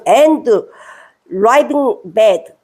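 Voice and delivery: "raving" drawn out very slowly; slow reading. Shown as a demonstration. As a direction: lay each word down slowly. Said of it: "너 정신 나가 미쳤어". (아주 느리게 또박또박)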